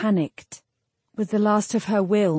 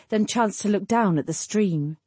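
ordinary delivery

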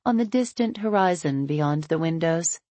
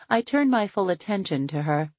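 plain reading voice

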